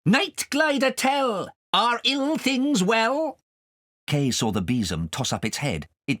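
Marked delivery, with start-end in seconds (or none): 0.0-3.4 high, slow, sharp calling voice; sharp witch call. A narrator's reading. Witch calling a spell to the broom, high and sharp. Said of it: "the sharp voice of Missus Pouncer calling".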